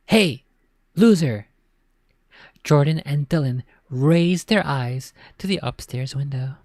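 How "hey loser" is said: shout. A narrator's reading. Older brother yelling down from upstairs, jeering shout. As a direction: yell down from the window. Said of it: "shouting from the window above".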